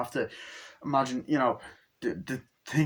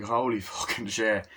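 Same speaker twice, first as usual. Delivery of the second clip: usual speaking voice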